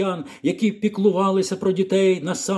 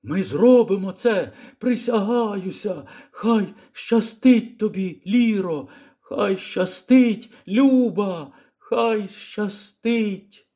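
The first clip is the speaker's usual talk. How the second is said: fading farewell call; 5 semitones higher, slower than usual. Acted. Old man calling farewell.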